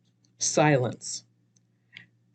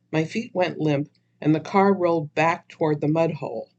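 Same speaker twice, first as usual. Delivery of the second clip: ordinary delivery, nothing marked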